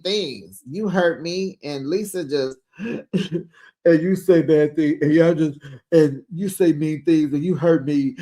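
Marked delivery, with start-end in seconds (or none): 2.8-5.6 slow, broken mock sobbing; mock crying. Imitating Lisa crying at Heather, mock sobbing. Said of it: "And she's crying". 5.9-8.1 hammed-up tearful complaint; mock crying. Imitating Lisa crying at Heather, mock sobbing. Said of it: "And she's crying".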